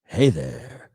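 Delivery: low, lightly voiced radio host hello; a hint of a radio host; Howard Stern voice. Shown as a demonstration. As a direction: drop it low like a shock jock. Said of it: "that sort of Howard Stern voice".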